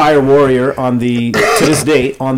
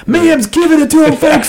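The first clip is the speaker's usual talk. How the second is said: wrestling promo; 13 semitones higher than usual. Pretend. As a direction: hype it like a ring announcer. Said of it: "You got the wrestling voice, the, the commentator voice down".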